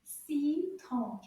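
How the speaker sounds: "Citron" drawn out with its two syllables apart; slow model. Shown as a demonstration. Teacher shows children the sounds in "Citron", slowly. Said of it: "say it a little bit faster".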